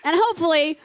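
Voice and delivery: loud and laughing; laughing storytelling. Real, not acted. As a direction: tell it through laughter. Wife acts out huffing in bed.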